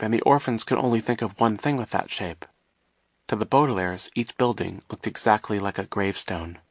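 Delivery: plain reading voice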